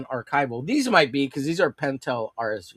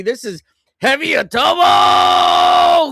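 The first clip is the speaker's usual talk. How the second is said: hype announcer; 9 semitones higher, 11 dB louder, slower than usual. Acted. Hyping up the comic character.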